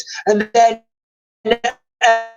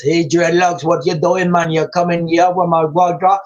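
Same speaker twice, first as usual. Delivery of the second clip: lower-pitched, badly faked Jamaican accent; Chris Eubank Senior; 5 semitones lower than usual. Fan mimics celebrity's put-on Jamaican accent, admittedly bad Jamaican accent. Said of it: "when he puts his Jamaican accent on".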